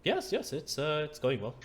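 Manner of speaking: high-pitched, eager reply; Mikhail. Acted. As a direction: start high, easing down. Game master answers as Mikhail.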